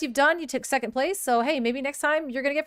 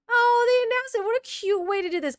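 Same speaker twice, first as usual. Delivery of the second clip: high, loud gushing; excited gush; 12 semitones higher, 5 dB louder than usual. Real, not acted. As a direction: gush over the news. Still thrilled at announcement.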